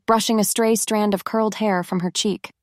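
ordinary delivery, nothing marked

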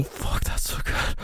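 slow breathy whispered pleasure. Acted. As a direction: let it fall to a hushed whisper. Breathless whisper after loud moan.

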